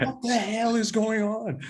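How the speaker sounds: very high, slow, bewildered; baffled barista. Retold or imitated. Barista baffled by the prank, very high and slow. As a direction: drag it out in total confusion. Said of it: "She's like".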